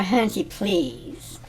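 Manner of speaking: slow, drawn-out hunty; hunty stretched slow and dismissive; passive-aggressive sass. Shown as a demonstration. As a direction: drag it out with attitude. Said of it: "Usually delivered with attitude".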